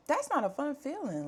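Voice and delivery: squeaky retold disbelief; incredulous. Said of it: "I'm just like".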